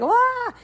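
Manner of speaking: high mock squeal of a kid gone crazy; kid's wild shriek. Kid letting go of the wall, mock high squeal. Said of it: "and like".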